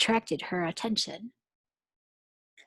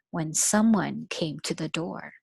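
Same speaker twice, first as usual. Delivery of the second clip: usual speaking voice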